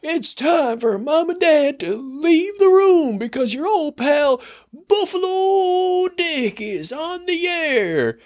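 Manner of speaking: high, slow announcer voice; radio announcer. Retold or imitated. Imitating the radio announcer clearing the room for kids. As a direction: boom it out like the old radio host. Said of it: "you'd hear the announcer go".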